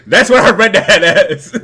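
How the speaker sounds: high, loud laughing words. Real, not acted. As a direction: talk through laughter. Laughing after wrestler bit.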